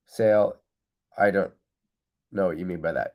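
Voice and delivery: ordinary delivery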